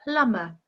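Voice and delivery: plumber, B left out; plumber with silent B. Shown as a demonstration. Teacher models plumber for learners, silent B. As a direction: model plumber without any B sound. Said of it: "the B is silent".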